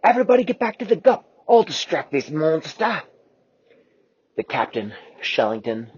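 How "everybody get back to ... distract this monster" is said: high, loud, quick command; urgent shout. Acted. Quasi shouting to the crew while he runs. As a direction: call the crew back.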